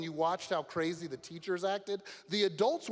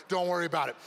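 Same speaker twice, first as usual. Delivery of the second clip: ordinary talk, nothing marked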